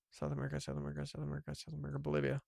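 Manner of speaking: very soft, low repetition; low, barely audible muttering; muttered thinking aloud. Real, not acted. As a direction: mumble it to yourself while searching. Thinking aloud while guessing.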